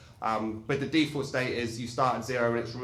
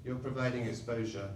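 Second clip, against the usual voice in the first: usual speaking voice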